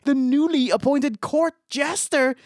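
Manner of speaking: mock grand introduction; ring announcer build. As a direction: draw out the big reveal. Hyping the surprise replacement.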